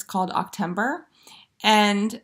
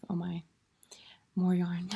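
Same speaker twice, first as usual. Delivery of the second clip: mock weary husband; 2 semitones lower, 6 dB quieter than usual. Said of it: "he just shakes his head and is like".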